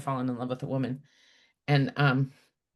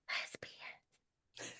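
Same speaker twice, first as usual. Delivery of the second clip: whispered; 19 dB quieter than usual. Retold or imitated. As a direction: whisper the shocking word. Grandma's scandalized secret word.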